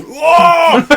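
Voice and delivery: high, loud mock cry of disgust; crowd's loud whoa. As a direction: shout it like the whole bar just smelled it. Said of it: "about thirty people were going".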